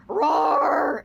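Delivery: loud, high drawn-out roar; monster roar. Acted. Reading a Godzilla spoof sticker aloud, roaring. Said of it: "it says".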